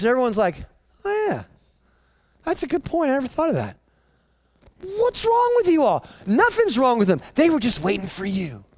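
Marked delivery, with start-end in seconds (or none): 1.0-3.8 mock agreement. Mocking everyone who suddenly agrees. 4.8-6.1 exasperated. Acted. Exasperated rhetorical outburst.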